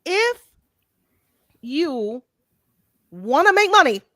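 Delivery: measured phrases with long pauses. Real, not acted. Host explaining the fan market to the guys, slow and deliberate. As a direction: spell it out piece by piece, emphatically. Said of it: "I'ma say it slower".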